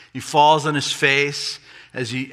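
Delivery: usual speaking voice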